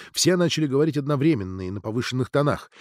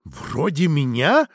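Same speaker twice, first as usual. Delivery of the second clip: slow, drawn-out indignant question; bristle, stretching the question out; flaring up; much slower than usual. A narrator's reading. Natalia takes offence at Ksenia's jab, flaring up. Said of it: "вспылила Наталья".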